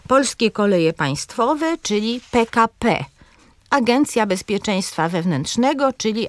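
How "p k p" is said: "P K P" as pe-ka-PE; stress last syllable. Shown as a demonstration. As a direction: spell out "P K P", leaning on the final letter. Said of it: "akcentujemy zawsze na ostatniej sylabie". („P K P” z akcentem na ostatnie PE)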